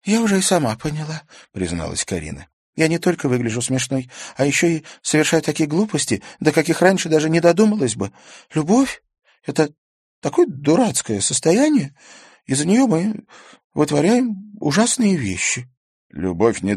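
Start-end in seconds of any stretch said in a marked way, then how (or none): 0.1-8.1 put-on higher young girl's voice, rueful. A narrator's reading. Karina admits her foolishness to Stas, higher girl's voice. 8.5-11.9 put-on high, loud, girlish complaint about любовь. A narrator's reading. Karina complains to Stas, higher girl's voice. Karina calls love stupid. 12.4-15.7 put-on higher young girl's voice, rueful. A narrator's reading. Karina admits her foolishness to Stas, higher girl's voice.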